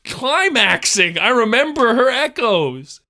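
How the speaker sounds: high, hammed-up declaiming; mock outrage. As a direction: proclaim it loudly in overblown indignation.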